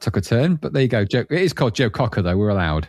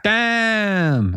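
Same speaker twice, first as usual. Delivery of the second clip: loud damn; 9 semitones higher than usual. Shown as a demonstration. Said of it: "You gotta say a loud damn for that".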